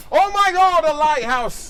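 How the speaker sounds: high, loud cry of discovery; excited cry. Acted. Larry spotting a lighthouse from the barge, excited high cry. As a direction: shout it like he's been saved.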